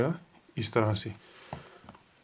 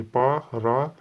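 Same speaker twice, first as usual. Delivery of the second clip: very slow, each part held apart; syllable by syllable; much slower than usual. Shown as a demonstration. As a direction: read पा, रा, सा out like a teacher. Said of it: "ये इसको पढ़ने का तरीका है". (धीरे-धीरे पढ़ना)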